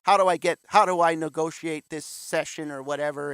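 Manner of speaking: mimicking callers. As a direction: voice the desperate pro on the phone. Drummers calling teacher for advice.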